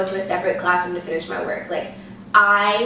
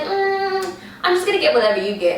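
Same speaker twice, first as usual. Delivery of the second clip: high, quick friend voice; 11 semitones higher than usual. Retold or imitated. Friend defers to her choice.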